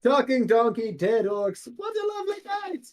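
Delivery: rejoice grandly, soaring up at the end; theatrical cry of wonder; Demos delighted. Acted. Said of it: "He goes".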